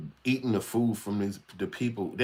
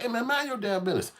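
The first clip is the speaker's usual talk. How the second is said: much higher, squeaky put-on voice; high-pitched mock comeback; mock retort; 12 semitones higher than usual. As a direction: snap back in a high mocking voice. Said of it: "Now you like".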